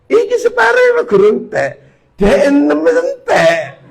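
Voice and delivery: high, loud astonished cry, laughing at the end; amazed exclamation. Retold or imitated. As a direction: marvel loudly that all six are gone. Amazed at six shawarmas eaten.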